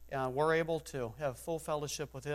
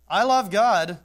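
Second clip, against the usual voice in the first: mock hypocrite; 5 semitones higher, 11 dB louder, slower than usual. Hypocrite's claim again.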